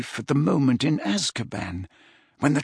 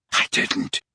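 whispered, no voice; breathed. A narrator's reading. Frightened boy denying it, in a whisper. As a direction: barely breathe it out. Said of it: "breathed Malfoy".

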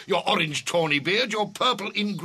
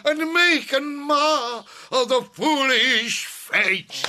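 high, slow, grandiose ranting; lofty tyrant's vein; 8 semitones higher, slower than usual. Acted. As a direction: declaim it like a swaggering tyrant. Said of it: "was lofty".

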